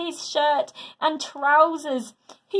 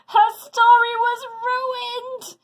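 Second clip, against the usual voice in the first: dismayed wail; 12 semitones higher, 6 dB louder, slower than usual. A narrator's reading. Dismay at ruined story.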